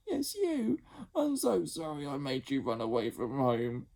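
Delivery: a big sister saying sorry; sorry older sister Jemima. Acted. Jemima voiced in story.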